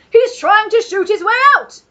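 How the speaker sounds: high, loud panicked Duke; alarmed shout. A narrator's reading. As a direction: blurt it in fright. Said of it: "the Duke shouted".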